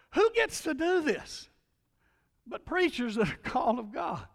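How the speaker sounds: delighted wonder. Retold or imitated. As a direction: marvel aloud with a laugh. Preacher's delighted prayer.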